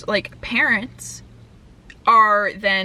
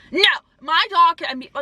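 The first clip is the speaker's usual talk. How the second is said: higher, emphatic mock refusal; defiant retort; 6 semitones higher than usual. Dog owner refusing the driver, mock defiant. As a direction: put on an indignant, stubborn no.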